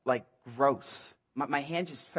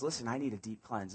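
softer, lower, intimate "need"; quiet plea; 4 semitones lower, 6 dB quieter than usual. Acted. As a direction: confess it under your breath. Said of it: "David says".

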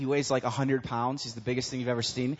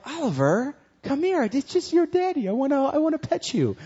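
much higher, sing-song coaxing; baby talk to cat; 10 semitones higher, 4 dB louder than usual. Retold or imitated. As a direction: coax a pet in a high cooing voice.

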